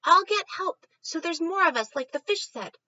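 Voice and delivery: very high, loud squeaky call; calling rat. Acted. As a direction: shout up in a tiny rat voice. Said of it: "The rat calls up to the squirrel".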